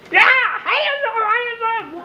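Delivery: very high, slow, strained yell; frantic shout. Retold or imitated. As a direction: scream the command with adrenaline. Officers shouting commands.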